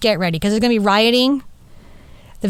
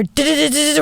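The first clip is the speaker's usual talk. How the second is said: a sung-out alarm siren, pitched a little higher; mock alarm siren; 5 semitones higher, faster than usual. At the cafe upstairs, mimicking the alarm going off, sung-out siren. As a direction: imitate the alarm wailing in short beats.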